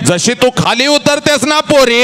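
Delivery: high ringing call; 1 preaching cry. Shown as a demonstration. Preacher calling out to the bride, high and ringing. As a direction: lift the line into a soaring call. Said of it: "ए".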